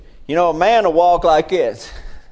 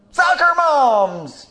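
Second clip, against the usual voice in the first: high, loud and slow; high slow shouted repeat; 12 semitones higher, 4 dB louder, much slower than usual. Real, not acted. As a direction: shout it so the back row hears. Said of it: "In case you didn't hear me the first time".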